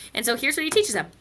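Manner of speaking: normal talking voice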